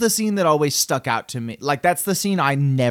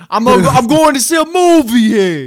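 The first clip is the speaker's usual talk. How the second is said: higher, louder tough-guy voice; Raphael impression; 7 semitones higher, 6 dB louder than usual. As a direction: sound like a gruff teen sneaking out. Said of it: "That's a spot on impression".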